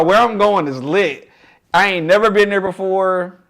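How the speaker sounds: normal talking voice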